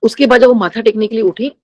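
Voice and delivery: ordinary delivery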